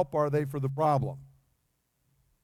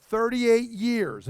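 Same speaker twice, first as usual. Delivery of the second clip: "Thirty-eight years" drawn out slowly, a little higher and louder; slow emphasis; 4 semitones higher, 3 dB louder, much slower than usual. Acted. Preacher to his congregation, stretching "Thirty-eight years" slowly. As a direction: lean on "Thirty-eight years" to make the wait felt. Preacher stressing the long wait.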